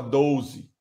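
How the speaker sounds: "Doze" as "douze", low and soft; carioca vowel. Retold or imitated. Man mimics a carioca answering, "Doze" as "douze". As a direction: glide the O of "Doze" into OU. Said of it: "no carioca eu falo assim".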